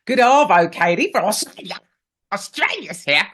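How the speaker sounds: a jokey Australian twang on a listener's hello; mock Australian accent. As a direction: read the greeting as a cartoon Aussie. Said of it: "Australians don't speak like that".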